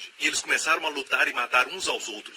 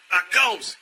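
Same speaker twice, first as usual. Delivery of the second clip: loud command; 6 semitones higher, 6 dB louder, slower than usual. Shown as a demonstration. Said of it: "Olha o que diz".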